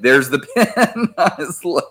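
real laugh breaking through the words. Real, not acted. Host showing a listener's pen tattoo, laughing.

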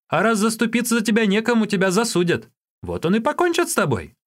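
high, animated voice of alarm; Юньге, scornful warning. A narrator's reading. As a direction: warn him off in a high pitch.

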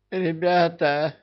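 high, slow squeaky yell; squeaky shout. Acted. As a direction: squeal it out in a thin voice. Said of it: "каким-то писклявым голосом кричит он".

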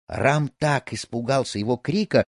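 ordinary delivery, nothing marked

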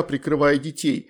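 usual speaking voice

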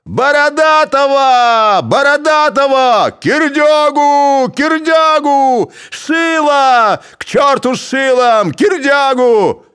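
very high, loud, slow overlapping yells; clamouring shout. A narrator's reading. Crowd shouting rival names, loud. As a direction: shout names over each other.

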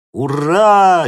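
high, loud hurrah; loud cheer. A narrator's reading. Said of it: "гаркнули спортсмены".

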